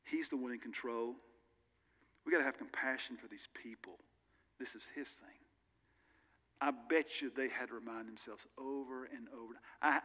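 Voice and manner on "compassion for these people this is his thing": whisper. Real, not acted. Sermon aside, voice drops.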